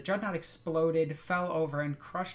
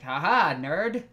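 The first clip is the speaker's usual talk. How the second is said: mocking; 9 dB louder, slower than usual. Victor mocks slain foe.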